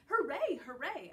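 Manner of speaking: high loud cheer fading down; happy cheer. A narrator's reading. Dog cheering at the dog park, excited.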